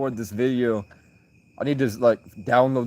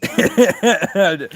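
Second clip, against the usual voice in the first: laughing; 9 semitones higher, 7 dB louder than usual. Real, not acted. Still laughing at chat.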